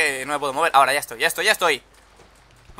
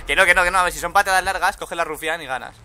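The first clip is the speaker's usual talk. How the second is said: ordinary talk, nothing marked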